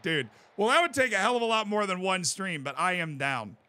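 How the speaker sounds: normal talking voice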